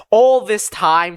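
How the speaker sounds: ordinary delivery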